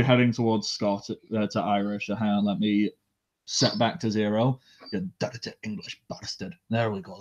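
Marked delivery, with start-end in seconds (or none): 4.9-6.6 Scottish phrase. Shown as a demonstration. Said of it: "That's the Scottish".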